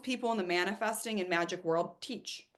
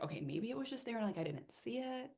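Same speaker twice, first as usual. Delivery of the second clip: softer, quick self-doubt; doubtful aside; 11 dB quieter than usual. Retold or imitated. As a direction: mumble the rationalisation quickly. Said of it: "And I'm like".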